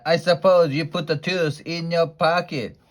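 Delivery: scornful queen yelling at Walter. Acted. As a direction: yell it down at the boy. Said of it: "yelled Queen Dentina".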